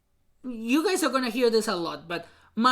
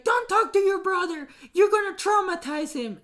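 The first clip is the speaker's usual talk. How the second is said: higher, sharp imitation of mom scolding; 9 semitones higher, 4 dB louder than usual. Mom scolding child not to talk to his brother, higher and sharper. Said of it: "my mom is all like".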